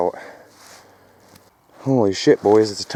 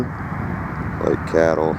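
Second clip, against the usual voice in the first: drawn out; 4 semitones lower, 4 dB quieter, much slower than usual. Pretend. Repeats cattle line slowly.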